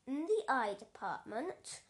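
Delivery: ordinary delivery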